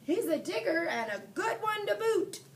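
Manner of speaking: high loud pirate shout; captain praising the boy to his crew, yelling; yelled to crew. A narrator's reading. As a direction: call it back to the ship. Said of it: "yelled back to his crew".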